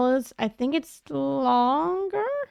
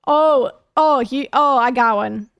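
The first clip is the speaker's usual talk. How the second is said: ordinary talk, nothing marked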